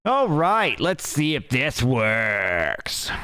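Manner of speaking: high, loud all right; excited. Real, not acted. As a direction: burst out eagerly. Exclaims as test starts.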